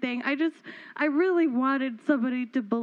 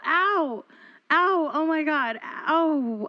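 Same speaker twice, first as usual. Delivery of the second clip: hammed-up yelps of pain, louder; mock pain; 3 semitones higher, 4 dB louder, much slower than usual. Neighbor fakes a twisted ankle, yelping in mock pain. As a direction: cry out over a pretend twisted ankle. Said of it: "I just twisted my ankle".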